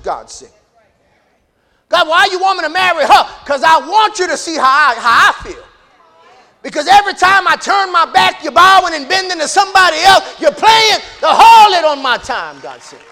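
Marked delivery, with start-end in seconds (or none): 1.9-12.6 loud, high cry as an aggrieved God; impassioned preaching shout. Acted. God telling Hosea of betrayal, impassioned shout. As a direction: build it into a full-voiced shout.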